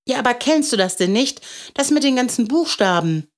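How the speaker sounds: put-on quick, high child's voice; astonished little boy. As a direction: blurt it out like an incredulous kid.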